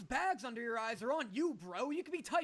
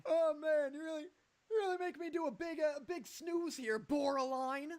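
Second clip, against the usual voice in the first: faking tiredness; 4 semitones higher than usual. Pretend. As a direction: fake yawning. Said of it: "You take a big fake yawn and you go".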